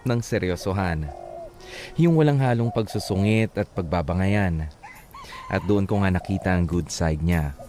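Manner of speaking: letter reading. A narrator's reading. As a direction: read the letter like a gentle radio story. Host reads the listener's letter.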